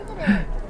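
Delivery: high sharp gasp; high little gasp; surprised gasp. Acted. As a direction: gasp in delight. Mouse sees her hat.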